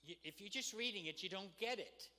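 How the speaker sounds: normal talking voice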